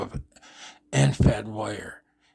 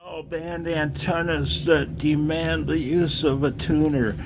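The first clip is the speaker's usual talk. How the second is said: lightly voiced know-it-all ham, sneering; mocking quote; 6 semitones higher than usual. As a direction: parrot the other hams with scorn. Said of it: "when I hear".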